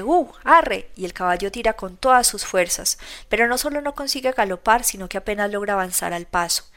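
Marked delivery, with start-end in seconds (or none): 0.0-0.9 high, loud cry to drive the horse; shouted giddy-up. Acted. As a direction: yell at the horse to go. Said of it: "Se oye el grito de".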